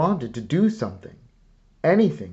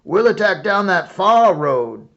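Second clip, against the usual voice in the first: loud, high and fast; shouted orders; 9 semitones higher, 12 dB louder than usual. A narrator's reading. Officer yelling his men away from the cannon. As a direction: rush the warning out at full voice. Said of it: "he cried".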